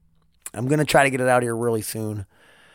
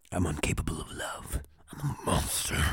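whispered, much softer self-accusation; mock dark whisper; 10 semitones lower, 11 dB quieter than usual. Podcaster mocking himself after praising his friend, whispered. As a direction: hush it like a spooky confession.

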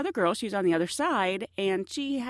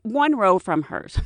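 ordinary talk, nothing marked

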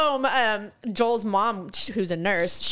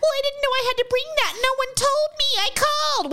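high whiny complaint, much higher; whiny customer; 14 semitones higher than usual. Retold or imitated. Mocking DMV customers who come unprepared, high whiny voice. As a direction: whine like a clueless customer at the counter. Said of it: "it's like".

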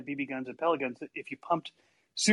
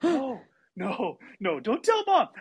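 higher-pitched urgent begging; mock panic, desperate to stop him; panicked pleading; 7 semitones higher, slower than usual. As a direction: imitate a kid in trouble pleading. Panicked plea to screaming brother.

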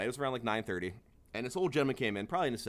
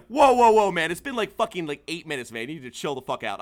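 heated pushback; 2 semitones higher, 8 dB louder than usual. Retold or imitated. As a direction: push back hard, then cool off. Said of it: "So I'm like".

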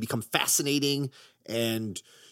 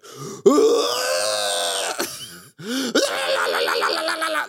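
gasping scream; 3 semitones lower, 5 dB louder than usual. Retold or imitated. Weird scream in sex scene.

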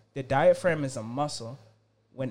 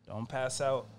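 ordinary talk, nothing marked

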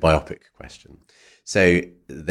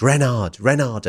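retold shout; 7 semitones higher than usual. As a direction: imitate mum calling out across the road. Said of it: "and I shouted".

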